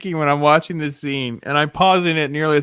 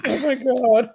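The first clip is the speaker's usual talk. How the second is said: laughing disbelief; 12 semitones higher than usual. Real, not acted. Laughing at the absurd movie.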